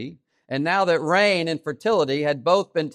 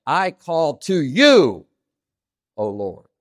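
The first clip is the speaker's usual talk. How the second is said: slow, loud "to you", "you" swooping up; stress on TO YOU; 3 semitones higher, 6 dB louder, much slower than usual. Shown as a demonstration. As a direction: punch "you", then drop off for "O Lord". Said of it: "I could say it like I just said it, or I could say".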